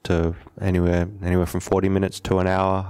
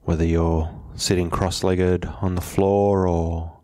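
unhurried meditation guide, long pauses between phrases; measured, settled meditation instruction; guided meditation; 2 semitones lower than usual. Acted. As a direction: calmly guide them to settle, leaving space after each phrase.

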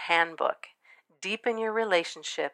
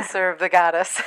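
louder, relished delivery of the phrase; reverent emphasis; 6 dB louder than usual. Real, not acted. Host enjoys saying her guest's business name, reverently. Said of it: "You say it with such reverence".

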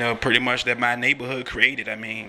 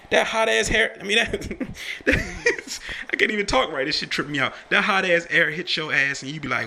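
ordinary talk

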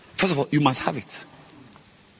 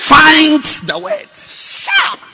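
rising preacher cry; 10 dB louder, much slower than usual. Acted. Pastor climaxes on the word.

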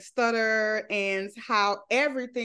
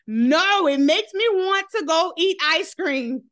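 loud protest; 6 semitones higher, 6 dB louder than usual. Real, not acted. Protesting family's weight teasing.

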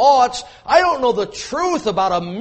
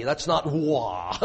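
ordinary talk

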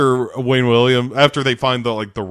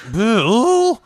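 whiny plea; 11 semitones higher, 3 dB louder than usual. Retold or imitated.

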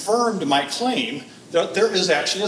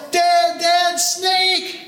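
high, loud, drawn-out call across the water; yell; 12 semitones higher, 4 dB louder, much slower than usual. Acted. Boy yelling to faraway dad about a snake, high drawn-out yell. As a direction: holler for Dad, stretched out and high-pitched. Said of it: "And so I yell".

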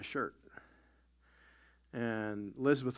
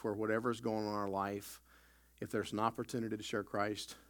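normal talking voice

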